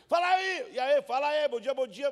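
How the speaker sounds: called out loud and high across the alley; cheerful shouted hellos to everyone in the favela; loud street greetings. Retold or imitated. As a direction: hail neighbours up and down the hill. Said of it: "porque você sempre tem assim".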